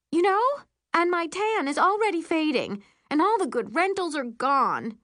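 high, dramatic complaining; whining stepmother. A narrator's reading. Stepmother complaining on without noticing the hold, whiny.

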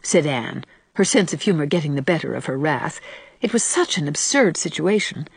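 usual speaking voice

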